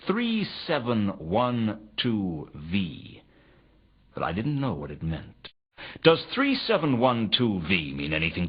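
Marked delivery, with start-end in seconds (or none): 0.1-3.2 each number read out slowly and separately; slowly sounding out a scrawled clue; slow deliberate reading. Acted. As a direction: read the code out slowly, puzzling over it. Detective reads out a cryptic message.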